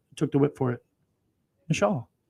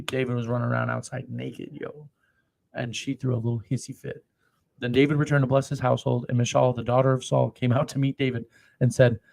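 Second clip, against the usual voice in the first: usual speaking voice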